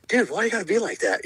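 high, exasperated plea to a buddy; mock protest. Protesting to a laughing buddy, high and exasperated. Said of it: "And I'm like".

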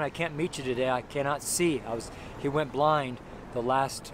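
ordinary talk, nothing marked

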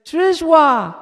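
high, loud toddler imitation; imitating Casey. Nella telling Chuck what Casey says, imitating the toddler. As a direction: mimic the little girl's word. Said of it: "when she says".

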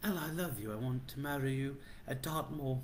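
badly faked Dartmoor accent; terrible Dartmoor accent. Host mock-proposing marriage at Saint Michael's, bad Dartmoor accent. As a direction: woo someone in a hammed-up Dartmoor accent. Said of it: "If you talk like that".

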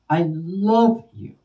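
LOVE high and drawn out, slow line; stress on LOVE. Shown as a demonstration. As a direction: stretch "love" high, then drop on "you". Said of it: "Same words, different intonations".

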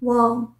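वार्म said as warm, like warm water; वार्म with an open ah vowel, as in warm; वार्म pronounced like English warm; warm said right. Shown as a demonstration. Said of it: "इसको हम pronounce करते हैं वार्म".

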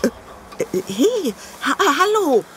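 stumbling, high, unsure hallo; startled stammer. Acted. Mother opens the door to an empty doorstep, startled stammer. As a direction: caught off guard at the door.

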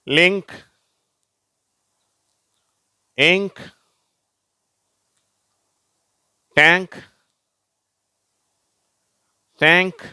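loud, high-pitched, one word at a time; model word reading. Shown as a demonstration. Teacher reading words for pupils to repeat, loud model reading. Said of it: "வாசிக்கிறேன்".

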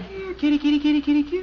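high sing-song kitty call; coaxing a cat. Acted. Dad coaxing a cat off the garage roof, high sing-song call.

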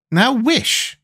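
high, anxious urging. A narrator's reading. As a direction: urge him on in a high, worried voice. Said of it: "said the Tin Woodman anxiously".

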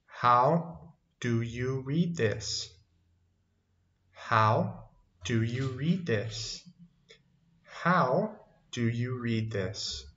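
very slow, evenly separated words; slow teaching model. Shown as a demonstration. Teacher models an English question for learners, slow and deliberate. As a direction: model the question at half speed.

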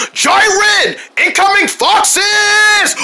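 high loud shouted alert; high urgent yell; warning shout. Acted. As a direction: call out the warning. Warning friend of crash.

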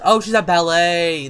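loud, drawn-out exclamation; sudden realisation. Real, not acted. Player works out where she was, loudly realising. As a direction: burst out slowly as it dawns.

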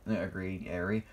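ordinary delivery

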